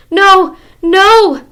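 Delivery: begging. Acted. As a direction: beg for mercy. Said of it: "begged the witch".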